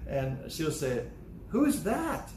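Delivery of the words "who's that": much higher, bright grandma question; grandma asking the boy, high and sweet. Retold or imitated. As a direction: coo it like a doting grandma. Grandma asking about the drawing.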